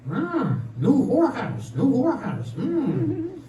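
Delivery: high, slow parrot squawk; talking parrot. Acted. Parrot looks around its new home, squawking. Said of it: "The parrot looks around and says".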